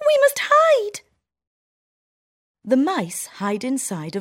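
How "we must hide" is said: very high, loud squeaky cry; shouting mouse. Acted. Town mouse warning her friend of the people, loud high squeak. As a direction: sound the alarm in a tiny voice. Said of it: "shouts the town mouse".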